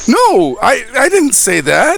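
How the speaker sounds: high-pitched protesting character voice; flustered denial. Acted. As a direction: hastily deny it to a wailing child. Accused player hastily denies it in character.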